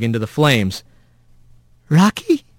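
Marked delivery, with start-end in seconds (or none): 1.9-2.4 high, rising weak question; strained weak rat. A narrator's reading. Rat to the armadillo by the fire, managing a strained question. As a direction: squeeze out a faint, higher-pitched question. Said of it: "Rat managed to get out".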